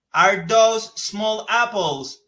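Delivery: slow, careful English question; slow model question. Shown as a demonstration. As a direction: model it word by word. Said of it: "Is this".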